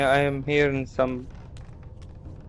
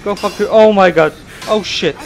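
loud angry panicked swearing; furious cursing; 6 semitones higher, 9 dB louder than usual. Real, not acted. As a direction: yell curses at the attacker. Caught, cursing at ghost.

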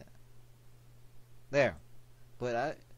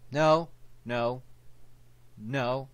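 loud, higher repeated no; exclaimed no; 3 semitones higher, 10 dB louder, much slower than usual. Real, not acted. Gamer reacting to the game, loud high no. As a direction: call out each no separately.

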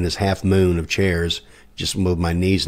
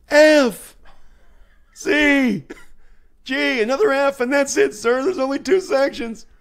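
very high falsetto yell; comic high-pitched screamed instructions; mock scream. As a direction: shriek chord names over the guitars. Screams chords again at rehearsal.

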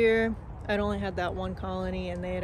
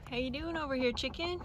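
higher, softer sing-song greeting to a hen; soft pet talk; 5 semitones higher, 5 dB quieter than usual. Real, not acted. Greeting the separated hen in her pen, higher and softer. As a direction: gently coo at the chicken.

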